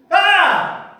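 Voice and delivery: high, shouted cry of pain; sudden wordless scream; loud cry. Acted. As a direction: cry out loudly in agony. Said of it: "I think it was just a cry".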